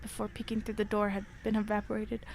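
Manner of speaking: low, hushed horror storytelling; story narration. A narrator's reading. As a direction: read it low and quiet, drawing us in. Reading horror story aloud.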